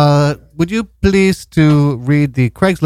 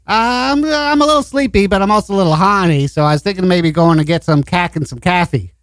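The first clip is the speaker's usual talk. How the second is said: put-on Boston accent; 6 semitones higher than usual. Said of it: "Boston accent".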